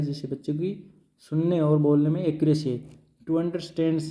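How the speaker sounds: ordinary talk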